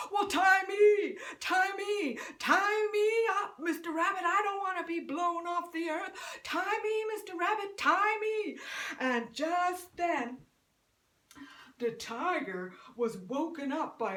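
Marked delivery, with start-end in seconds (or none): 0.1-8.6 high, loud pleading shout; shouting animals. Acted. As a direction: creatures yelling to be tied up. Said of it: "the animals shout real loud".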